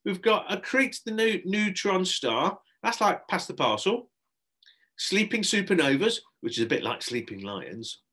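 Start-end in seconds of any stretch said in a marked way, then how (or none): 0.0-6.2 high, loud party-host pitch; eager children's entertainer voice; Shelley enthusing. Acted. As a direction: talk up the games to reluctant children. Shelley selling party games.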